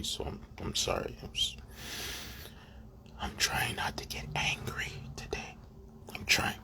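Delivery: under the breath, barely voiced; whispering. Real, not acted. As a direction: whisper it to yourself, holding back anger. Apologising under breath, trying to stay calm.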